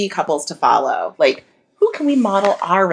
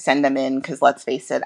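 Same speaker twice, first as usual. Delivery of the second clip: normal talking voice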